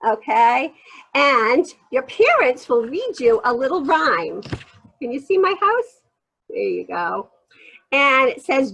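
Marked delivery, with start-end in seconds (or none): none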